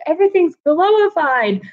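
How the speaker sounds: put-on high, wide-eyed child marvelling; amazed kid voice. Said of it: "She's like".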